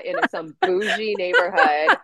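laughing through the words at the absurd picture; a light giggle breaking the line. Real, not acted. Telling the host a funny story, laughing. Joking about wearing a balaclava.

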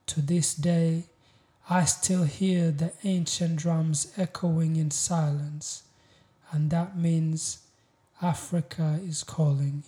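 proud, weighty spoken-word delivery; spoken-word poem. Acted. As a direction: declaim each line with deliberate pauses. Recites a poem.

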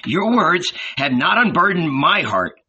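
put-on high, earnest young man's voice; pleading young disciple. A narrator's reading. John questions Jesus about forgiveness, pleading. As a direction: appeal earnestly, voice lifted and urgent.

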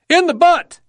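very high, loud yelled answer; yelling back. A narrator's reading. Marine answering his buddy's shouted question, yelling back. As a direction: yell it back across the battlefield. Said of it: "someone yelled back".

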